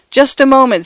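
high, hurried appeal; pleading with the thunder; urgent plea. A narrator's reading. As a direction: hold the storm off for a second. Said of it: "said Mister Fotheringay to the lightnings".

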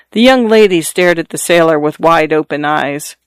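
usual speaking voice

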